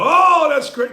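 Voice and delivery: a sudden high, loud mock cheer. Host mocking fans who cheer a long throw, loud and high. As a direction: cheer like a wowed fan.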